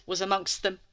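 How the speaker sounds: ordinary delivery, nothing marked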